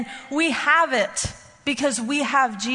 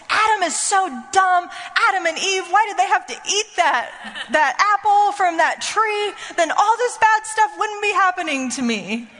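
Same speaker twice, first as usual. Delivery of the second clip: put-on whiny teenage son; 7 semitones higher than usual. Mother imitating her thirteen-year-old complaining at breakfast, high whiny voice. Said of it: "He goes".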